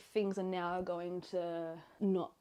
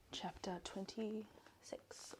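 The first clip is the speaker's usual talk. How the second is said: geflüstert; 11 dB quieter, much slower than usual. Real, not acted. Beginnt flüsternd zu lesen.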